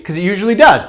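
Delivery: normal talking voice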